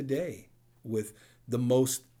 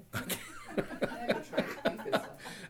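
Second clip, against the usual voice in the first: a breathy self-mocking laugh, pitched up; laughs at himself; 6 dB quieter than usual. Real, not acted. Preacher laughing at his own old confessions, a breathy chuckle.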